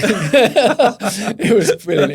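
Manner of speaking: loud laugh, then slow words through it; cracking up at his own story; remembering the buyer's phone call, laughing out loud; real laughter. Real, not acted. Cracking up after his anecdote.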